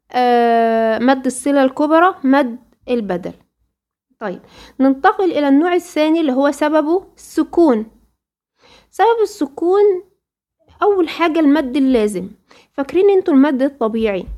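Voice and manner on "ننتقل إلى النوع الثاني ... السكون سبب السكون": ordinary delivery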